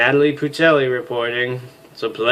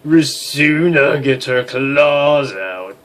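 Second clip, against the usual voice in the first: slow dramatic; 3 semitones higher, 5 dB louder, much slower than usual. Acted.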